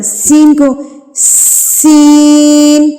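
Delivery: long hissed سین; whistling letter. Shown as a demonstration. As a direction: hiss the s in سین. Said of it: "سیٹی کی آواز پیدا ہوتی ہے".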